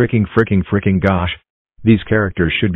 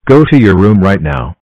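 loud order; 7 dB louder than usual. Acted. Parent orders child away.

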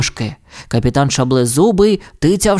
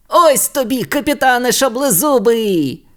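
higher, gleeful victory cry; triumphant shout; 8 semitones higher than usual. A narrator's reading. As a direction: gloat at the pirate. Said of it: "вигукнув тато". (переможний вигук)

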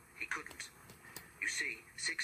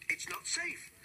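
shouting foreman. A narrator's reading. Foreman running up and shouting at the driver. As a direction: call out in alarm across the yard. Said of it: "he shouted".

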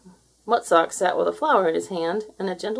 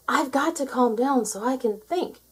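higher voice, a touch anxious; seal steadying itself; 6 semitones higher than usual. Acted. Seal thinking to itself, nervous. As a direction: talk yourself into calm. Seal's frightened thought.

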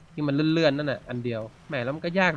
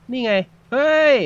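high, loud burst of delight; excited shout; 9 semitones higher, 9 dB louder than usual. Real, not acted. Gamer yelping at his new invisibility skill, excited. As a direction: yelp as the skill kicks in.

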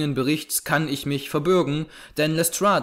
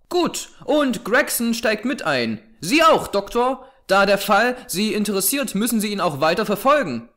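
high-pitched, lively detective voice; animated, much higher voice, taking charge; brisk, commanding detective; 7 semitones higher than usual. Acted. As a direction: hand out the arrangements in a raised, animated pitch.

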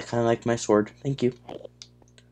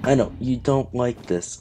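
ordinary talk

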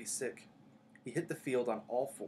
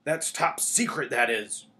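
loud, slow, gruff warning; 11 dB louder, slower than usual. A narrator's reading. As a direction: close the subject gruffly, leaning on the words. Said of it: "said Hagrid gruffly".

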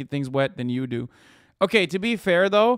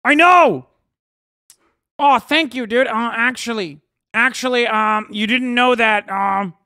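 higher, louder mock gratitude; sarcastic thanks; 7 semitones higher, 8 dB louder than usual. Streamer sarcastically thanking a chatter for the correction, high mock-grateful voice. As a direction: thank them as if they'd told you the obvious.

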